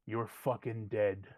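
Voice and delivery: a hint of a menacing thug; menacing brute. Hired brute promising to earn his pay, low menace.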